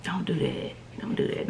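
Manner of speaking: soft scolding. Shown as a demonstration. Softly warning viewers.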